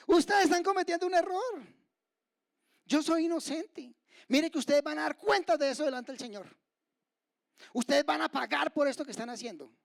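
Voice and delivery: high, loud indignant protest; mock protesting shout. Preacher imagines Stephen warning his stoners, protesting shout. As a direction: shout warnings at accusers, hammed-up. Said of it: "se puso a gritar".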